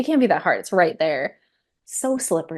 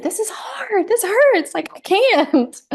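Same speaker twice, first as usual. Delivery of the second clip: much higher, a strained mock wail of pain; overwhelmed inner voice; 14 semitones higher, 4 dB louder, slower than usual. To herself as labor peaks, much higher, mock-pained wail. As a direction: imitate her inner self falling to her knees. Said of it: "I didn't say any of this out loud".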